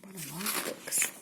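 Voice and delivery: awed little whisper. Acted. Narrator to children, marvelling at the books, whispered. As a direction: breathe it out in wonder.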